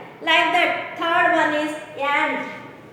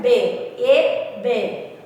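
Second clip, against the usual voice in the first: BAY and A pushed out louder, each on its own; raised voice; 3 semitones lower, 5 dB louder, much slower than usual. Shown as a demonstration. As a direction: punch out "bay", "A", "bay" as a drill. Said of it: "raise your voice like that".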